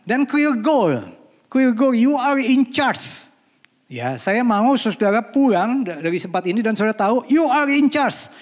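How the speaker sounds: high, loud exhortation to the congregation; forceful preaching. Real, not acted. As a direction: drive each phrase home at full voice.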